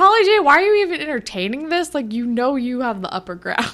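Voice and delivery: high, fast, incredulous plea; like yelling at the screen in disbelief; exasperated. Retold or imitated. Viewer scolding a TV character, high and fast. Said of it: "And I'm like".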